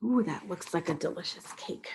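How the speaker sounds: usual speaking voice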